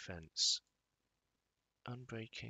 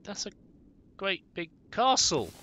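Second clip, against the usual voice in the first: awed; 6 semitones higher, 10 dB louder than usual. Real, not acted. Spots a castle in game.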